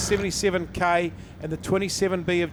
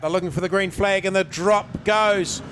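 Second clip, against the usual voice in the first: building from level to a louder, higher call at the start; rising race call; 3 semitones higher, 3 dB louder than usual. Real, not acted. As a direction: ramp up as the green flag drops. Race start called live.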